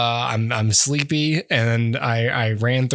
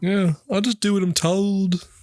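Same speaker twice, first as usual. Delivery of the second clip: lightly held put-on high singer voice; Walk Hard impression; 6 semitones higher than usual. Host teasing the guest, in a high put-on voice. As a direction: stay in the Walk Hard character voice. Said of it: "your impression is so, uh, walk hard".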